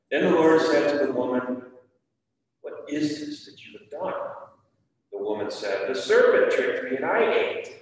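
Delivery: reading the Bible aloud to the class, firm and clear; scripture reading. Acted. Teacher reads Genesis aloud to the children, steady reading voice. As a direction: read it like a lesson for kids.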